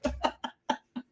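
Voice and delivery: high airy giggle; breathy laugh. Real, not acted. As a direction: giggle without voice.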